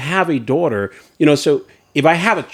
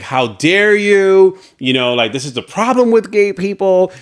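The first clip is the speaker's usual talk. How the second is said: high, slow, indignant "how dare you"; mock outraged commenter; 6 semitones higher, 4 dB louder, slower than usual. Husband quoting hate comments in a mock outraged voice. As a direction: ham up the offended Facebook troll. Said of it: "and it was like".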